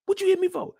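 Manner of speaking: high whining question; whiny Doughboy. Retold or imitated. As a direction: whine it like a hurt kid. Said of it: "He said".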